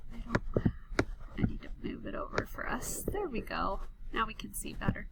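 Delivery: whispered aside while fixing the screen; quick hushed mutter to herself. Real, not acted. Teacher fixing the screen, whispering to herself.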